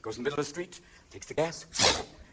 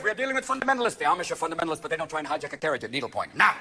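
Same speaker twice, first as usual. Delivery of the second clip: normal talking voice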